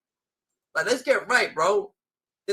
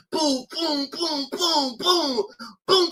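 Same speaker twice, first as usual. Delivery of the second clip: imitated gunshots; 7 semitones higher, much slower than usual. Ranting about how he got shot, mimicking the gunfire. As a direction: rattle the booms off like rounds hitting. Acting out the eight shots.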